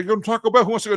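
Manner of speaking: a cheery hypothetical invite to buddies; mock invitation. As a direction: pretend to round up a group for Taco Bell. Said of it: "no, I'd never say".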